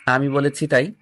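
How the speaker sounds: ordinary delivery, nothing marked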